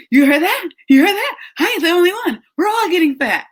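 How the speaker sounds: high, loud crowing; hyped gloating. Retold or imitated. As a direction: crow it like a thrilled kid. Son gloating about friend's weight.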